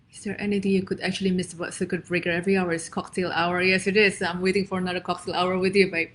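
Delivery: ordinary delivery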